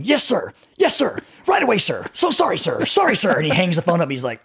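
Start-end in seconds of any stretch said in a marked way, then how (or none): 0.0-3.4 high, rapid-fire apologetic yes-sirs; cowed gunnery sergeant. Retold or imitated. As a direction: imitate a sergeant being chewed out by a superior. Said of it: "you just hear this yelling, and then".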